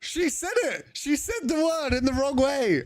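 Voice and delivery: very high squealed protest; mock outrage. Streamer reacting to text-to-speech saying the hated word, squealed mock outrage. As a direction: squeal it in hammed-up horror, drawn out.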